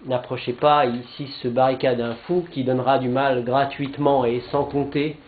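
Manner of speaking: declaimed verse with measured pauses between phrases; poem recitation. Acted. Speaker recites Jean-Pierre Remet's first canto to listeners, declaimed poem. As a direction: recite it line by line, rising in force on the pleas.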